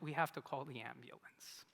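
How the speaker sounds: hushed whisper, almost no voice. Retold or imitated. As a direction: drop to a whisper. Back seizes, calling the ambulance.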